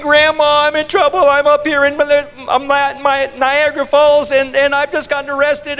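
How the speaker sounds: high, wailing mock sobbing; crying grandson. Grandson crying to grandma on the phone, mock sobbing. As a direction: sob out the plea like a scared kid. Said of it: "her grandson is crying".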